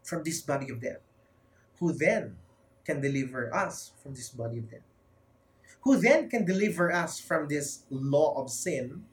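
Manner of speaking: usual speaking voice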